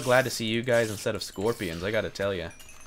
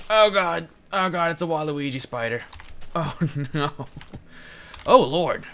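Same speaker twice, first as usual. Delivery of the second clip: high, loud startled cries at a spider; panicked shriek; 5 semitones higher, 6 dB louder than usual. Real, not acted. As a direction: yelp in real fright.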